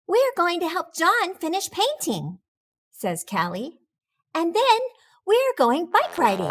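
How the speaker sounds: put-on high bright girl voice; lightly voiced young girl. Callie voiced higher.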